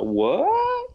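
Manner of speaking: mock shock, voice leaping way up; startled. Hero under surprise attack, high-pitched startled cry. Acted startled reply in retold fight.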